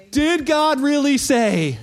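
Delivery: drawn-out insinuating question. Retold or imitated. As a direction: stretch each word to sow doubt. Quotes Satan's question in Eden.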